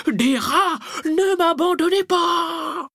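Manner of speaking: very high, loud scream of terror; panicked howling. A narrator's reading. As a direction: shriek it, dragging out the last word. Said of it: "se remit à hurler".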